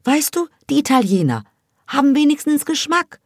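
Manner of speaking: scornful gossip. A narrator's reading. A girl sneering at the boys to her friend, high and scornful. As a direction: look down your nose at them. Hava mocks Turkish boys' taste.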